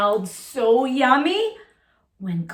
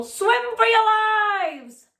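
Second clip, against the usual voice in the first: panicked cry; 11 semitones higher, slower than usual. Acted. Joey warning the playground of the squid, high panicked shout. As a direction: sound the alarm to everyone. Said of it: "cried Joey Mackerel".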